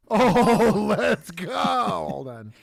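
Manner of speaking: loud excited cheer. Real, not acted. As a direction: cheer at the good score. Excited at scorecard.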